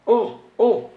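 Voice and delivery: "U" and "O, O" as the Tupi sixth vowel y, a tight unrounded close vowel said with a pen held in the mouth; "O, O" said loud and high with the lips spread around a pen, like a Tupi y; pen in teeth. Shown as a demonstration. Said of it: "você põe a caneta na boca e fala o".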